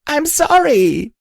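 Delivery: high drawn-out sob; sobbing. A narrator's reading. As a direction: break down on the apology. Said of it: "as I broke into sobs".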